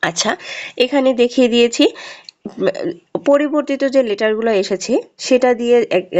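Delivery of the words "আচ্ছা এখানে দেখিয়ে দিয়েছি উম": ordinary talk, nothing marked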